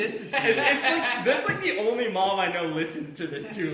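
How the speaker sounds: talking through real laughter, high and loud; laughing. Real, not acted. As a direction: laugh your way through the words, loudly. Bursts out laughing while talking.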